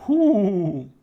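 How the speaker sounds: high, loud blown-out sigh of relief; relieved whew. Acted. Player escaping the flame trap, loud relieved whew. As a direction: let the danger go in one breath.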